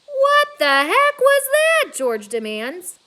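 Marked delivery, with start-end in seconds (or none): 0.1-1.9 high, loud, slow startled cry; startled dad. Acted. Startled father demands an answer, high loud cry.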